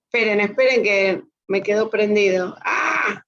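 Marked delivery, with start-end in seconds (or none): none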